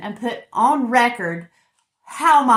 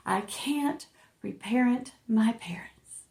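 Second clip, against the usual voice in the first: slow, whispered affirmation; soft, breathy, measured recitation; hushed mantra; 6 dB quieter, slower than usual. Pretend. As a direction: whisper it slowly, word by word, like a chant. Group mantra said all together.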